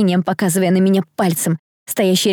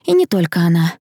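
ordinary delivery

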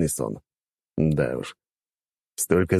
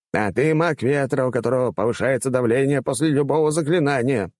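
high-pitched rector brushing someone off; dismissive old rector; 6 semitones higher than usual. Acted. Rector dismissing a wind mage's objection, dismissive. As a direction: wave away the objection, voice pitched up.

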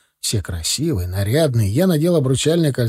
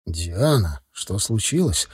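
low, quiet insistence; understated pressure, kept low; pressing question; 5 semitones lower, 5 dB quieter, slower than usual. A narrator's reading. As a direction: ask softly but firmly, pressing for an answer. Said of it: "с нажимом спросил я".

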